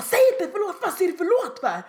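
high, loud mock scolding; acted angry screech; screaming at partner. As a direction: imitate a furious customer berating someone. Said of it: "bara stod och skrek".

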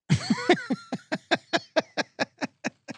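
high squealing laugh. Real, not acted. Comic laughs at himself in the box, high squeal.

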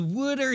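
"Wooder" for water, with a short "oo"; mock Philly "Wooder"; attempted regional pronunciation. Shown as a demonstration. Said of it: "I can't mimic it at all".

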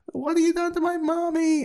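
put-on very high little kid's voice; small child voice. Small child to the killer, very high and pleading. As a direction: squeak it out like a tiny child. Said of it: "the child is like".

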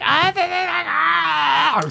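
very high, drawn-out mock squeal; mock babbling wail. Comic mocking a self-absorbed date, high babbling wail. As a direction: babble high and slow like an attention-seeker.

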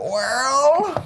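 put-on high-pitched Southern old-timer voice; exaggerated Southern twang, way up high; southern accent. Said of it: "I don't know why I have a southern accent".

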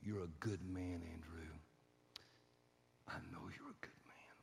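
low, soft affirmation fading to whisper; hushed, earnest reassurance; tender blessing. Retold or imitated. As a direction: gently bless a husband. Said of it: "And then she blessed him".

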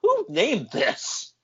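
can't believe the name; mock bewildered; baffled. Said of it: "And I'm like".

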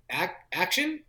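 hesitant, questioning call with rising pitch; unsure. Retold or imitated. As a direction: stumble into it as a question. Unsure first-time director calls action.

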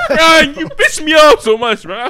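high, loud, laughing mock anger; mock-scold him while laughing; laughing exasperation. Real, not acted. Scolding a friend for the guess, loud laughing mock anger.

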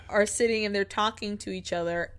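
usual speaking voice

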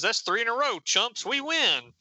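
acted victory taunt; triumphant gloating. Dirk crowing at the losing team, triumphant and high. Comic character gloats over a win.

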